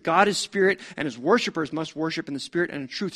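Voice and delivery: usual speaking voice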